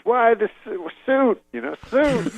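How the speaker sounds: very high, halting comic question; high-pitched puzzled mimicry; Bill Cosby. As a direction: mimic him in a high, choppy voice. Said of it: "He goes".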